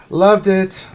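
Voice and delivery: high, loud burst of delight; excited. Real, not acted. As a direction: exclaim it with relish. Repeats praise of own team.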